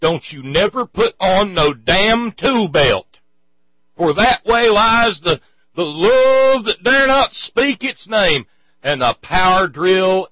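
loud, high hammed-up backwoods preacher; mock preaching rant. Comedian mocking the preacher's rules for women, hammed-up rant. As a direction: bellow it like a mock fire-and-brimstone sermon.

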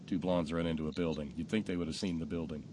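low, soft aside; deadpan repeat. Real, not acted. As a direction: repeat the joke under your breath. Retells punchline as deadpan aside.

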